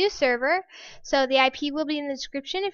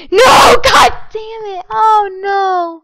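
loud, high cry of no; furious shout; 6 semitones higher, 14 dB louder, slower than usual. Real, not acted. Player falling into the pit, furious loud scream. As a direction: scream in rage as you fall.

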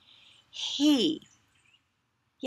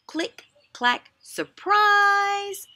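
surprise bursts out much higher and louder; excited title; 12 semitones higher, 6 dB louder, much slower than usual. Shown as a demonstration. Teacher excitedly announcing the book title to kids. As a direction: announce the title like a party reveal.

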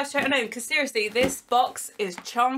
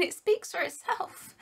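ordinary talk, nothing marked

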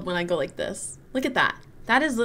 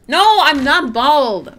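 high, drawn-out indignant denial; slow, loud wail of protest; 5 semitones higher, 10 dB louder, slower than usual. Real, not acted. Streamer denying viewers' bald teasing, loudly protesting.

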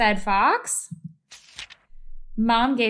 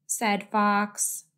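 usual speaking voice

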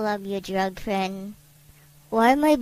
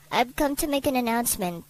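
ordinary delivery

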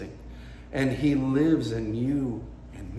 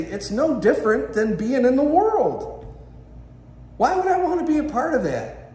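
high, loud protest of a disillusioned visitor; passionate; 10 semitones higher, 4 dB louder than usual. Acted. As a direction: voice soaring in indignant disbelief. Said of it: "saying this so passionately".

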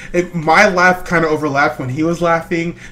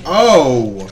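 ordinary talk

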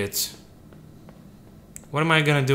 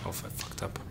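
breathy whisper to yourself; 15 dB quieter than usual. Real, not acted. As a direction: whisper it, caught out. Mistake, muttered to self.

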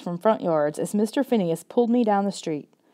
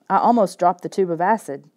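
ordinary delivery, nothing marked

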